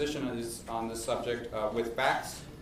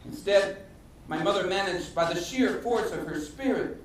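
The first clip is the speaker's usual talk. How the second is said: higher-pitched, theatrical monologue with deliberate pauses; Raul H. Castro; 7 semitones higher than usual. Acted. Playwright performing a former governor's life story, theatrical monologue. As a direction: declaim a life story phrase by phrase.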